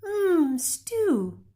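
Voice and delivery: put-on high, longing child's mmm; dreamy sigh. Hungry boy dreams of Amu's stew, high and longing. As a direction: savor the word like a hungry kid. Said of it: "he sighed".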